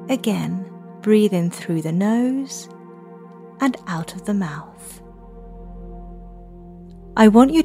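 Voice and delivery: soft spa whisper. Acted. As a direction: guide the children calmly to breathe. Leads children's breathing meditation.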